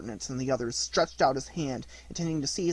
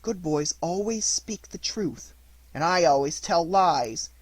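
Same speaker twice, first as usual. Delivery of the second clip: usual speaking voice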